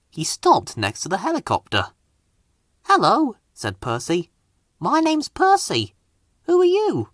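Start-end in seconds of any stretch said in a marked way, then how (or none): 2.8-7.1 bright, much higher engine voice; a friendly little engine saying hello; Percy the engine. Acted. Small engine greeting a helicopter, high and friendly.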